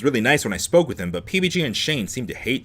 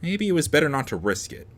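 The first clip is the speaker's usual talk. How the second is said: usual speaking voice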